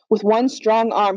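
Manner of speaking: plain reading voice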